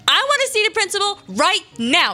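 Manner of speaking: put-on bossy kid demand. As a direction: insist like an angry little kid. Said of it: "I was pretty aggressive".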